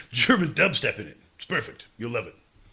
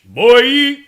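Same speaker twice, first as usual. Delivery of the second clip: Jamaican shout; 8 semitones higher, 15 dB louder than usual. Pretend. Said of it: "Oh, so you're Jamaican".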